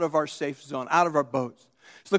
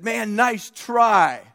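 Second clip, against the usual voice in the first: higher, louder, stretched-out exclamation; mock congratulation; 4 semitones higher, 5 dB louder, much slower than usual. Preacher imagining Jesus praising Peter's attempt, mock congratulation. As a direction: play up the praise for a laugh.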